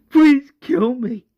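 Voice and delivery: high, loud, slow plea; acted anguished cry; desperate begging. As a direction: beg for it in anguish. Morgan begs to be killed.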